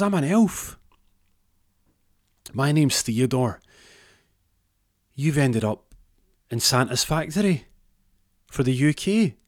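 high, perky elf introducing himself; little elf voice. Acted. Elf welcomes man to Santa's factory, high little voice. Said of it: "a little voice says".

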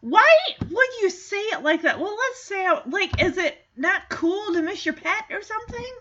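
high, baffled disbelief; can't believe he said that; incredulous. Real, not acted. Baffled by Jamie's wording.

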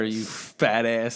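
drawn-out taunt, slow and low; slow mocking insult. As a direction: drag it out like a jeer. Mocking mayor's daughter insult.

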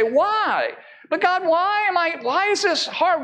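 voicing a sufferer's protest, high and strained; anguished why. A suffering believer asks God why, pleading.

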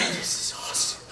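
laughing whispered awe; hushed, giggly amazement; awed whisper. Retold or imitated. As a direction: breathe it out in wonder. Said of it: "I'm just like".

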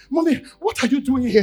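surprised mommy. Acted.

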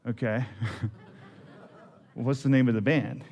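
laughing through the question. Real, not acted. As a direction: chuckle out the words, amused. Laughing at wife's band story.

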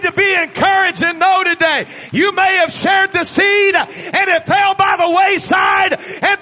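high, loud, driving sermon shout; impassioned preacher, key words stretched and belted; full-force preaching. Real, not acted. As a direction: preach it out to the whole congregation.

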